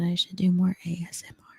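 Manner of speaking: hushed ASMR whisper; breathy whisper, barely any voice. Shown as a demonstration. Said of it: "Should I do some whispering".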